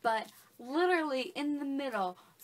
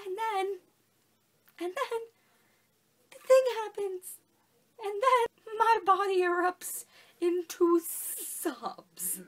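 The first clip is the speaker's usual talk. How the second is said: climbing much higher and louder to a slow loud peak; rising to outburst; 9 semitones higher, 7 dB louder than usual. Retold or imitated. Telling viewers the moment the book broke her, rising to a loud high outburst. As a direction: build from breathless to a loud wail. Climax of retelling her crying.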